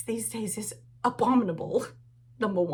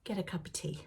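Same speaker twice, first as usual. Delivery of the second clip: soft aside; 9 dB quieter than usual. Shown as a demonstration. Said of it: "there's one important thing you have to do".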